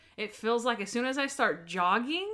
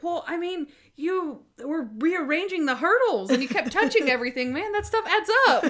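lightly voiced excuse; 7 semitones higher, 4 dB louder than usual. Voicing Ray's excuse.

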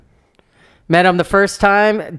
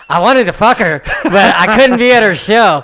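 laughing; 5 semitones higher, 8 dB louder than usual. Retold or imitated. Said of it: "He's like".

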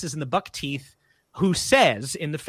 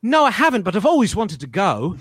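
good British accent; 2 semitones higher, 4 dB louder than usual. Shown as a demonstration. Kid surprising British director, spot-on British accent. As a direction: answer in a showy British accent. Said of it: "I can do a good British accent".

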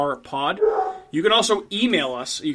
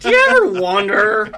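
higher, louder grumpy commentator; Andy Rooney impression; 5 semitones higher, 5 dB louder than usual. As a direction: ham up the old TV essayist. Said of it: "Andrew can be like".

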